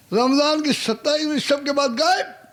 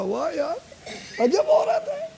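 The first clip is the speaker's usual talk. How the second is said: laughing, voice jumping high; a laughing, squeaky wow; amused disbelief; 7 semitones higher than usual. Acted. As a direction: chuckle in wonder at her. Robber amazed at Rabia's calm.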